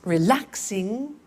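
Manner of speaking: Relaxing drawn out slowly, re-LAX-ing; slow teacher model; slow syllable demo. Shown as a demonstration. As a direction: stretch Relaxing for counting.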